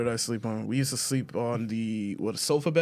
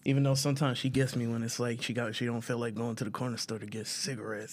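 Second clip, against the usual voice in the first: whispering; 3 semitones lower, 7 dB quieter than usual. Real, not acted. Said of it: "He whispering like she can't hear him".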